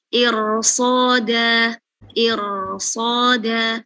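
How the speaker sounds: "irsoda" stretched out very slowly; very slow demo. Shown as a demonstration. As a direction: draw out "irsoda" syllable by syllable. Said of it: "Contoh lagi". ("irsoda" diucapkan sangat pelan)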